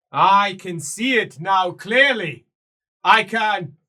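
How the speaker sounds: building from firm to a high, loud cry; rising prophetic fervour. Acted. Seer builds from firm speech to a prophetic shout. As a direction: let the vision swell to a shout.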